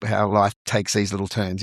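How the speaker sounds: normal talking voice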